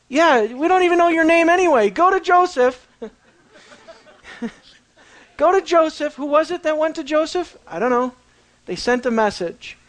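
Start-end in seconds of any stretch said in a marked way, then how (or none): 0.1-6.1 higher, louder, brushing him off; dismissive order. Acted. Brothers dismiss the servant they cannot name, higher and louder. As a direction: wave the servant off.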